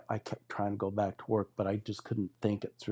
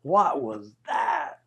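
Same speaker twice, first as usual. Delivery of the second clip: slow, high-pitched retold disbelief; baffled demand; 5 semitones higher, 3 dB louder, much slower than usual. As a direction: drag out the question, baffled. Retelling his baffled reaction to falling.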